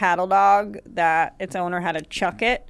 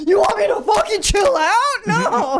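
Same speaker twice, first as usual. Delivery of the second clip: much higher, louder mock outburst; Louis raging; 13 semitones higher, 4 dB louder than usual. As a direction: blow up at the polite request. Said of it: "if you say something back like".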